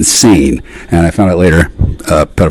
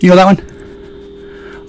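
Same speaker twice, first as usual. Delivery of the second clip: taunting; 10 semitones higher, much slower than usual. Acted. Taunting drawn-out question.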